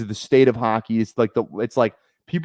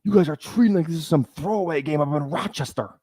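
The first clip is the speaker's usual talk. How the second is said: higher-pitched put-on coach voice; movie coach's scolding; 5 semitones higher than usual. Streamer quoting Miracle coach to players, mock scolding. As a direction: imitate a coach berating his team.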